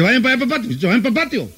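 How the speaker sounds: put-on nervous mother shooing the kids to the patio. Nervous mother shoos kids away.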